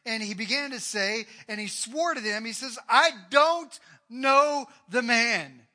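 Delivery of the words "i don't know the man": loud, very slow, every word hammered; furious sworn denial, slow and loud; cursing denial. Acted. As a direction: drag out each word as an oath. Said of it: "began to curse and swear".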